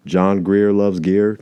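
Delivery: ordinary delivery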